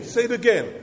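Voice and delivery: loud and high, pushing them on; loud urging. Acted. Urging congregation louder.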